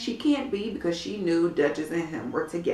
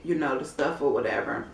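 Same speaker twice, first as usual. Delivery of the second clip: ordinary talk, nothing marked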